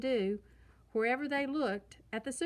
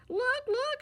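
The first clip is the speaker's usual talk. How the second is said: much higher, louder, thrilled squeal of a little cub; excited shout; 5 dB louder than usual. A narrator's reading. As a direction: shout it like a kid spotting a new ride. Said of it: "shouted Sister".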